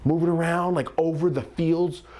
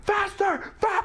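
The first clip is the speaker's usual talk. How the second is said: high excited yell out the window; yelling to speed up, hanging out the car window; yelling faster; slower than usual. Retold or imitated. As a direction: shout it high over the wind. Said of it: "I'm hanging out of the window going".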